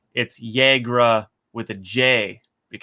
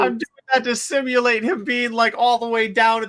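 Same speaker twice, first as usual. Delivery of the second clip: high, fast voice, as if from afar; muffled far-off voice; 11 semitones higher, faster than usual. Shown as a demonstration. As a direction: hand over mouth, like calling from the arena floor. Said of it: "you do the shaman's voice".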